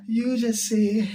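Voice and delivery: slow, drawn-out officiant cue; mock pastor prompt. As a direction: drawl it like a pastor leading vows. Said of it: "The osofo be can be a".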